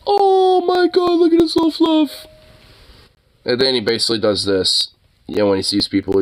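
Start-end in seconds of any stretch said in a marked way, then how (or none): 0.1-2.3 very high, slow squeal; gushing dog lover. Retold or imitated. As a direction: coo over a fluffy dog. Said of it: "they're like".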